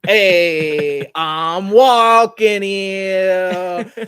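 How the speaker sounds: put-on loud, drawn-out New York cabbie accent. Co-host jokes he's a New Yorker, loud New York accent. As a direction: ham up a New Yorker yelling at a car. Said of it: "You, you become a New Yorker?".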